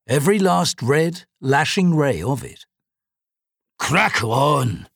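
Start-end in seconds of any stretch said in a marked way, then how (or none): 3.8-4.9 put-on old man grumbling. A narrator's reading. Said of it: "the old man grumbled to himself".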